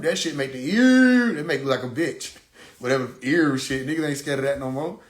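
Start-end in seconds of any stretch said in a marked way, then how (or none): 0.6-1.3 long, high, loud held note; drawn-out vocal siren; imitated siren wail. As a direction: stretch it like an old-style siren.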